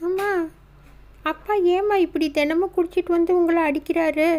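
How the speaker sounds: put-on a little girl checking if her mother is hurt; worried child Meenu. Daughter asking her mother if she is hurt, worried child voice.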